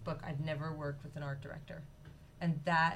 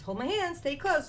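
high, loud bossy mother hen; Mama Chicken voice; 9 semitones higher, 5 dB louder than usual. A narrator's reading. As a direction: call it out like a brisk mom waking her chick. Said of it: "and she says".

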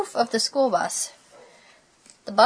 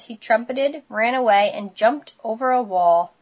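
ordinary delivery, nothing marked

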